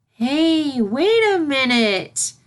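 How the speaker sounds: high, slow, eager filly voice; a young filly struck by an idea; sudden excitement. Acted.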